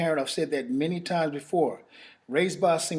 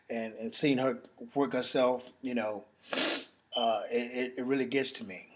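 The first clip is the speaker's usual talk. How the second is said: choked up; 3 semitones lower, 6 dB quieter than usual. Real, not acted. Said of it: "I don't mean to get emotional".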